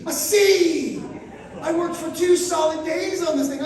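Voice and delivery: loud, high-pitched indignant outburst; pissed off. Retold or imitated. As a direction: exclaim it in outraged disbelief. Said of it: "I was pissed".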